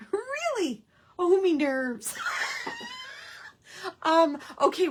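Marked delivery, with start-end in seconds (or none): 0.1-2.2 high, loud delighted reaction; excited squeal. Real, not acted. Crafter reacting loudly and high to a viewer's word. 2.2-4.0 shrieking delighted laugh; high laugh. Real, not acted. Crafter laughing hard at a viewer's phrase.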